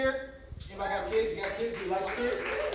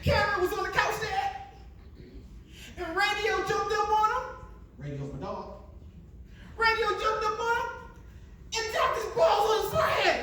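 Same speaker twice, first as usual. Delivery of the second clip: put-on kids' voice; 12 semitones higher than usual. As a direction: tell it like a giggling boy. Kids explaining, high voices.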